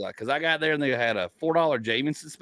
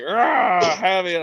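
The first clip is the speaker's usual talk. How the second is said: mock drunken roar; 6 semitones higher, 4 dB louder, much slower than usual. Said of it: "You were just like".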